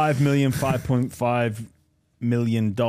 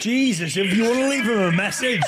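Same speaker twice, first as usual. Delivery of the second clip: high, quick words through real laughter; laughing exasperation; 11 semitones higher than usual. Real, not acted. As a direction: complain while cracking up. Laughing at the long greeting.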